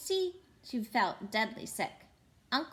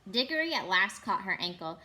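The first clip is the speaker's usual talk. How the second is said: ordinary delivery, nothing marked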